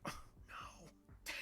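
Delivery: breathy whispered moan of a sick man. Retold or imitated. Retelling how sick he was, in a weak whisper. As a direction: whisper it weakly, too ill to talk.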